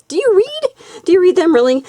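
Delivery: playful high mock disbelief; teasing. Real, not acted. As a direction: tease them, pitch way up. Teasing viewers about reading instructions.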